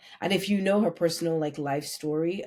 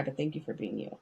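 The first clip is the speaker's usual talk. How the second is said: sincere and much softer, lower; tender, softer thanks; 4 semitones lower, 8 dB quieter, faster than usual. Real, not acted. To a moved friend, soft sincere thanks. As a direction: thank you said low and gentle. I'm not trying to make you cry.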